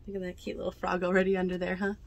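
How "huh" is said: ordinary delivery, nothing marked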